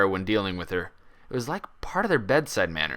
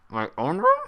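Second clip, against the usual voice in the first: muffled; 6 semitones higher, 5 dB louder than usual. A narrator's reading. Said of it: "her voice muffled by the sandwich in her mouth".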